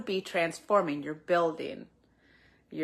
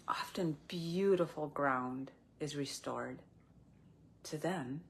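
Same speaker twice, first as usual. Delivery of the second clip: whispering; 6 dB quieter than usual. Real, not acted. Softly drops into a whisper.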